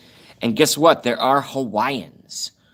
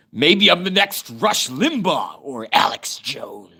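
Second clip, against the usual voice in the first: higher, slow, drawn-out mock pundit voice; booming radio-host imitation; 4 semitones higher, slower than usual. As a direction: ham up a talk-radio blowhard, stretching the names. Mimics big radio hosts after voice compliment.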